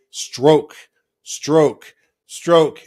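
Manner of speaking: called out loud, one word at a time; calling the stroke. Acted. Small leader at the back of the boat to rowers, calling out. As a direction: project each word like a coxswain.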